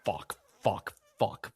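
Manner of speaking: low, quiet defeated swearing; hushed, hopeless cursing; mock despair. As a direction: swear to yourself while doomscrolling. Acts out despairing at bad news.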